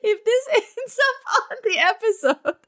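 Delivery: high, laughing mock-German accent; giggly, botched German accent; bad German accent. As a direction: fake a German accent while cracking up. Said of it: "This accent is so bad".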